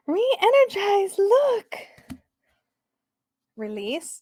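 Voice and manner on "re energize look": high, drawn-out gasp of delight; delighted squeal. Real, not acted. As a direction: squeal it high and slow, thrilled by the card. Gasps delightedly at the card.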